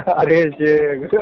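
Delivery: long, loud stretched call; loud drawn-out interruption. Real, not acted. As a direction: drag it out loud to cut in. Caller calls over the cleric.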